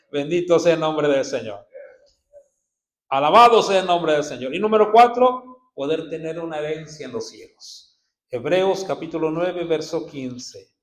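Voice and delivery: loud, driving pulpit reading; preaching at full force. Acted. Preacher proclaiming Hebrews to the church, loud and fervent. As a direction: hammer each phrase out to the crowd.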